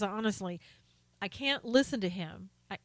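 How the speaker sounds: normal talking voice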